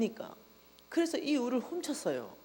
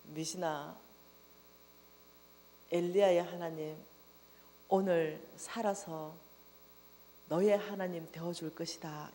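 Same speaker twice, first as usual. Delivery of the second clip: soft, slow, solemn promise; 하나님의 부드럽고 느린 음성; God's gentle voice; 4 semitones lower, 5 dB quieter than usual. Retold or imitated. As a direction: speak low and tender as God. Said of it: "그 말씀을 하시는 거예요".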